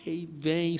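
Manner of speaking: ordinary talk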